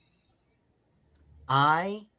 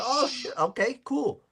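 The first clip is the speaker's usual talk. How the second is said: high surprised reply; 8 semitones higher than usual. Retold or imitated. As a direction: react with sudden surprise. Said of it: "And I said".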